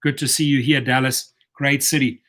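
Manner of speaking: usual speaking voice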